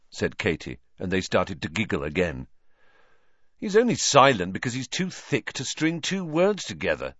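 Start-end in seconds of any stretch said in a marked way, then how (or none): 3.6-7.1 high, impatient put-down; exasperated and pitched up. A narrator's reading. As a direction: dismiss it with impatience. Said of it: "said Fred impatiently".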